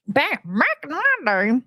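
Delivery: high, slow old-timer put-on; mock old-timer. Playing an old-timer reminiscing about work, mock old-timer. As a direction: drag it out, high and creaky-old for a joke.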